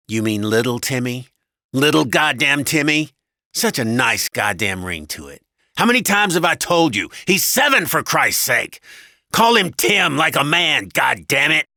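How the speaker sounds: loud, exasperated husband's tirade; angry husband. A narrator's reading. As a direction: rant like a bullying husband.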